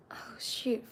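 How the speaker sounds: whispered to herself, startled; a quick breathy aside under the breath. Real, not acted. Realising the stream went quiet, whispered.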